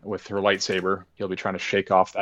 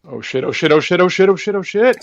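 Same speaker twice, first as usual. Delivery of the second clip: rising panic, louder and higher; panicked, building; 9 semitones higher, 9 dB louder than usual. Pretend. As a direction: panic building with each repeat. Fire racing toward them.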